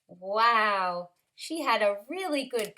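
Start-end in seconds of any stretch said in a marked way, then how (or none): none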